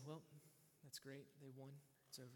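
low, hushed and composed, no excitement at all; mock-stoic understatement of a win. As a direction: react to victory as if nothing happened. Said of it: "stoic, everything composed".

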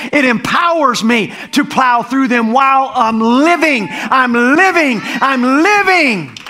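slow, drawn-out preaching cry. Acted. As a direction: stretch out I'm living.

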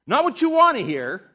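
high, loud punch line; fervent shout. Real, not acted. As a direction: hammer the contrast home. Preaching contrast punchline.

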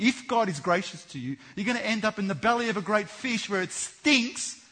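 loud, high preaching to the congregation; preaching at full force. Acted. Preacher warning the congregation, at full force. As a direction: drive the warning home from the pulpit.

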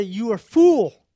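a loud, scolding "You are a fool."; loud mock scolding; mock angry rebuke. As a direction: bark it like a harsh husband. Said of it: "He didn't say".

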